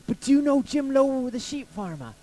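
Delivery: imitated stranger pressing the point; insistent question. Acted stranger repeats question insistently.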